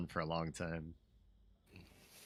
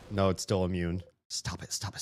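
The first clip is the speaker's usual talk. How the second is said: ordinary talk, nothing marked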